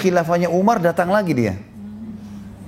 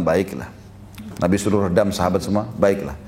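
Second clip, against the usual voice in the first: low, soft, unruffled agreement; calm reply; 8 semitones lower, 3 dB quieter than usual. Acted. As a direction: settle the room gently. Prophet calmly soothing tense crowd.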